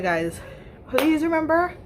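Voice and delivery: ordinary talk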